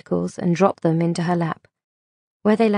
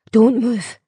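toughening up; 4 semitones higher, 4 dB louder than usual. A narrator's reading. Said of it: "toughening up".

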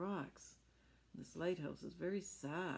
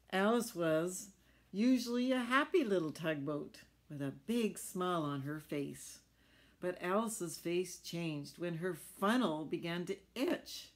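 lively storybook reading voice; animated picture-book narration; reading to children; 5 dB louder than usual. A narrator's reading. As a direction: read it big and bright for little ones.

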